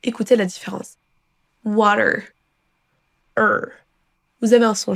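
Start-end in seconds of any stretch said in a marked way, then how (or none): 1.6-3.7 WATER ending in an American R; American WATER, then the R-coloured ending alone. Shown as a demonstration. Teacher showing the US difference, WATER with curled final R. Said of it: "une sorte de R roulé à la fin".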